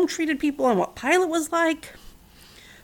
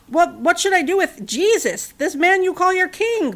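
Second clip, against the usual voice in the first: higher, pressing question; demanding; 7 semitones higher, 3 dB louder than usual. A narrator's reading. Pilate demanding the crowd decide about Jesus, pressing tone. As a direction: push the crowd for an answer. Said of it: "Pilate demanded".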